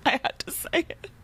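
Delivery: high-pitched giggly laugh-talk. Real, not acted. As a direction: get it out through helpless giggles. Laughs at own joke.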